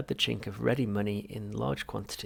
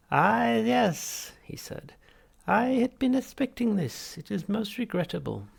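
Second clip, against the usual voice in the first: much higher, louder unctuous therapist; Halfrunt voice; 12 semitones higher, 7 dB louder, slower than usual. Acted. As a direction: greet the patient with oily cheer. Psychiatrist voiced.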